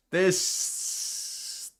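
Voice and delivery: ordinary talk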